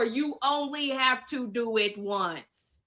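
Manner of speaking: slow, deliberate, word by word. Shown as a demonstration. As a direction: spell it out slowly so it sinks in. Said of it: "I'ma say it slower".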